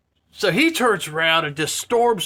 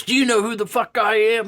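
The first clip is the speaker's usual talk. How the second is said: indignant retold challenge, raised pitch; retold loud challenge; 6 semitones higher, 3 dB louder than usual. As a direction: imitate a man getting loud in line. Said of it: "he's getting loud in the".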